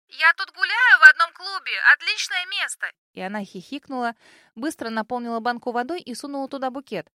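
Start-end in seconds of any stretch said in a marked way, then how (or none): 0.1-2.9 high, loud yell into the phone; shouting over music. Acted. Drunk friend shouting in club.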